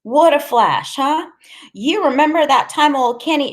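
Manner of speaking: high, loud, reminiscing woman's voice; chatty Loretta. Acted. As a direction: crow over old times, high and lively.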